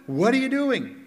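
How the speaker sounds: challenging. Acted. Acted challenge by the donkeys' owner.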